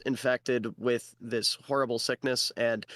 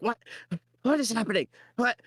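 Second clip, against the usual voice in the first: panicked little goblin, mock screaming; 9 semitones higher, faster than usual. Small goblin lost in the afterlife office, mock screaming. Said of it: "he starts screaming".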